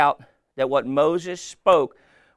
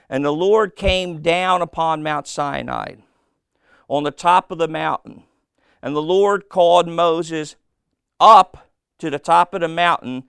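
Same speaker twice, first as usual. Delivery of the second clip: louder proclaiming read; scripture reading; 3 semitones higher, 5 dB louder than usual. Acted. Preacher reading Moses' summons aloud, loud and proclaiming. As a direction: call out the verse with force.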